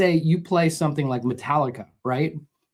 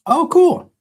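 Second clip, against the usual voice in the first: high, loud burst of delight; light up at the idea; pleasantly surprised; 9 dB louder than usual. Real, not acted. Reacting to a chat question.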